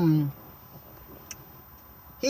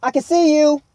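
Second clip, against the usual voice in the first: put-on childish bickering; 5 semitones higher, 5 dB louder than usual. Plays childish back-and-forth argument.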